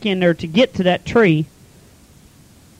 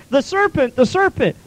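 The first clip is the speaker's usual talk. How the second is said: high, mocking whine; whiny Eve excuse; 8 semitones higher than usual. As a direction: pass the buck in a put-on high whine. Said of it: "And she goes".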